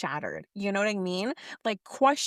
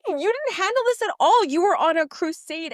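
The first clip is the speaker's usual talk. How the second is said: exasperated scolding; 8 semitones higher, 6 dB louder than usual. Real, not acted. Scolding a TV character.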